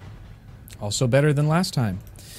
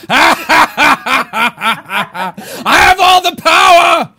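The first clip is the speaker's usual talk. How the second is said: high, loud cackling boast; laughing gloat; 13 semitones higher, 9 dB louder, much slower than usual. Acted. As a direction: gloat through a laugh, slowly. DM gloating at players.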